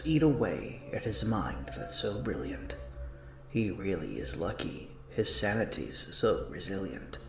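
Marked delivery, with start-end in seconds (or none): none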